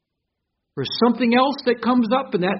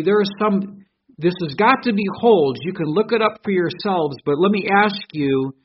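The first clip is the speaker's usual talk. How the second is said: normal talking voice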